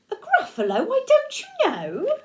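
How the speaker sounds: a gleeful squeak of disbelief; amazed mouse. Acted. Mouse laughing at the owl's ignorance, high.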